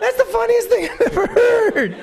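very high, squealed mock scream; hysterical delight. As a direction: squeal it as if helpless with hilarity. [name] collapsing in hilarity.

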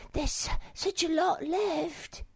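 very high, slow little creature voice; slow down in a high voice, as if distracted; Sniff trailing off. A narrator's reading. Sniff spots something among the garnets, trailing off. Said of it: "he trailed off".

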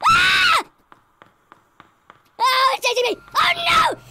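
high, loud screams of terror; terrified screaming. Real, not acted. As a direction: scream while being chased. Being chased by monster.

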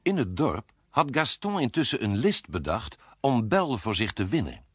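ordinary delivery, nothing marked

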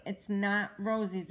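usual speaking voice

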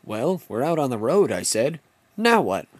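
usual speaking voice

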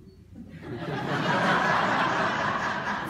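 laugh. Real, not acted. Laughs at own joke.

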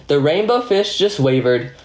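usual speaking voice

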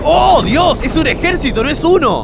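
high, loud cry of amazement; astonished outburst. Real, not acted. Player seeing a whole army appear, astonished shout.

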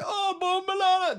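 loud, very high drawn-out cry; imitated Jamaican accent, erupting in outrage; mock Jamaican freak-out. Jamaican guy erupting at the insult, mock freak-out. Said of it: "they started freaking out".

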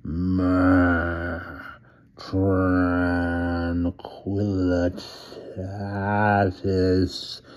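a werewolf half-growling his words; inhuman growl. A narrator's reading. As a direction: drag each word out as the man turns to wolf. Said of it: "His voice grew more and more inhuman".

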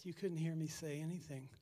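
usual speaking voice

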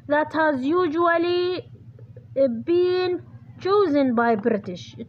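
very slow, word by word, for writing down; slow dictation. Shown as a demonstration. Teacher dictating the answer to her students, slow and deliberate. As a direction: spell it out slowly so students can copy.

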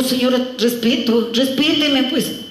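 high-pitched mock indignation; hammed-up offended tenant; indignant protest. Acted tenant demanding respect.

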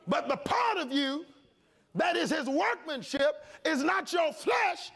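high, loud, slowed declaration; preacher pressing the point on flesh; preaching shout. Real, not acted. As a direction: drive it out with force. Preacher climax.

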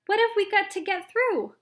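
high, loud question; alarmed shout. A narrator's reading. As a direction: shout over the wind. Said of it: "shouted Grandpa Joe".

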